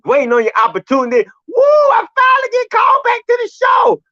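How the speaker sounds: shouting high and loud at the pitcher, fed up; furious rant. Real, not acted. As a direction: blow up at him like a betrayed fan. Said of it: "Red had to come on here and let these emotions out".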